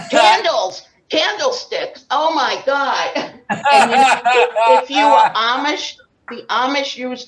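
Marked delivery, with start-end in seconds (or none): 0.1-2.1 high and loud comic reveal; loud punchline. Real, not acted. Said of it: "What did we use?". 2.1-6.0 laughing. Real, not acted. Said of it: "laughing at own joke".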